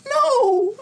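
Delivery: shocked no. Retold or imitated. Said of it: "she stopped in her tracks and went".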